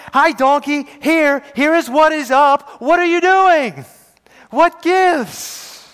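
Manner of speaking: high, loud, hammed-up squawk of a prophet; comic Balaam voice. Preacher voices Balaam talking back to his donkey, high and loud. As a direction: play Balaam as a silly, indignant man.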